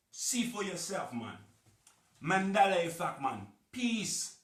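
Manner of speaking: high, loud mock Jamaican accent; hammed-up Jamaican accent, slow and booming. Vlogger signing off to viewers in a loud Jamaican accent. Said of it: "That's my Jamaican accent".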